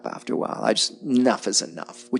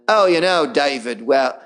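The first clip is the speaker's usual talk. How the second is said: high, put-on evasive voice; Bear Grylls, deflecting; 5 semitones higher than usual. TV survivalist evades talk show host's question, hammed-up deflecting. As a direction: dodge the question like a slick politician. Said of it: "deflect like a beautiful politician".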